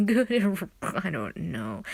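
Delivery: put-on slow, lightly slurred drunk voice. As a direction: drawl it like a tipsy drinker. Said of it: "That was not a good drinking voice".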